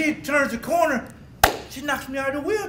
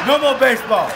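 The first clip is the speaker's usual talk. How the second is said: emphatic shout; 2 semitones higher, 8 dB louder than usual. Real, not acted. Mom ends baseball, shouted.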